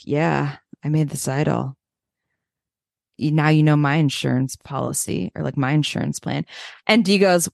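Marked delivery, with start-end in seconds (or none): 0.0-5.4 low, eerie imitation of Sean; lower creepy Sean. Mocking Sean's creepy idol reveal, low and eerie. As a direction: make him sound like a horror villain. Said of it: "his thing of being like".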